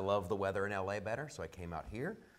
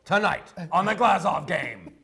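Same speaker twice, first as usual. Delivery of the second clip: big booming announcer parody, laughing, higher and louder; mock announcer; 7 semitones higher, 10 dB louder than usual. Guest parodying the host's intro with a booming announcer voice. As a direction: ham up a newsreader intro.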